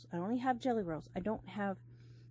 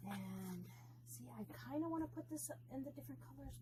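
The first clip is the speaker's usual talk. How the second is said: whispering; 6 semitones higher, 9 dB quieter than usual. Real, not acted. Thinking aloud to herself.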